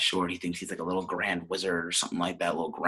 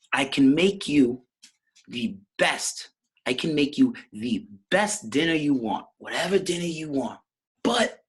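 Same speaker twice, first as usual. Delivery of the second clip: higher mom voice, leaning on key words; Nicky's ma; 7 semitones higher, 4 dB louder than usual. Acted. Ma offers her son any dinner, acted mom voice. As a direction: sell it like a proud mother. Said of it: "She said".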